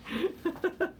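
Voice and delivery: short squeaky laugh; high giggle. Real, not acted. Streamer laughing at a viewer's joke, squeaky giggle. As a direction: let out a high little laugh.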